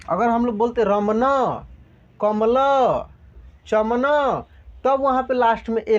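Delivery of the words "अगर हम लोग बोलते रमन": usual speaking voice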